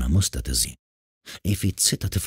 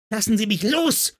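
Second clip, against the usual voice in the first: high-pitched, strained yell without full volume; acted girlish roar, pitch way up. A narrator's reading. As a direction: cry out high, struggling to get free. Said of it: "brüllte die Kleine".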